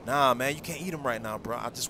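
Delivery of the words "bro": ordinary talk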